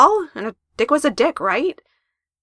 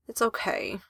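miserable; 8 dB quieter, slower than usual. Acted. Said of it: "he said miserably".

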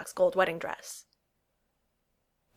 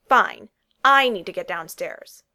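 curt anger; 8 dB louder, slower than usual. Acted. She storms out, angry.

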